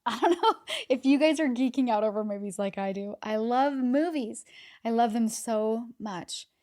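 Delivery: loud and laughing, bursting with love for movies; real delight, loud and bubbly; giddy gushing. Real, not acted. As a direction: let the excitement spill out with a laugh. Gushing about loving movies.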